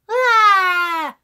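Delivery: high-pitched little trilled laugh; SpongeBob. Shown as a demonstration. Streamer explaining the technique, high SpongeBob laugh. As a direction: burst into a loud high vibrating cackle. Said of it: "a high-pitched little laugh type thing".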